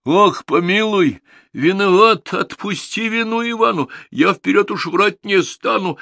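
high, loud pleading for mercy; begging Ivan. Acted. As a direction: beg the king like a scared servant. Ivan begs forgiveness.

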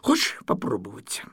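high, sweet-talking cat voice; butter him up gently; coaxing tender voice. Acted. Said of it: "таким ласковым голосом сказал".

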